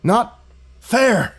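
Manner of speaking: put-on old man seething; bitter outburst. A narrator's reading. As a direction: spit it out bitterly. Old man's resentful outburst.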